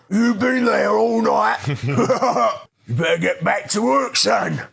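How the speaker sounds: high, loud, slow cockney chef scolding; cockney accent. Retold or imitated. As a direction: bark it like a cockney boss. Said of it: "Cockney chef walks right up to me saying".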